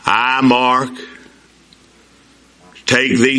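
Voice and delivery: groom vowing to his bride, slow and solemn; solemn vows. Retold or imitated. Repeating the wedding vows.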